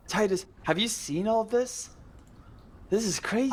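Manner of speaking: high, drawn-out amazement; awestruck excitement. Acted. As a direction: marvel at the cave to your brother, pitch up. Ace marvels at the cave.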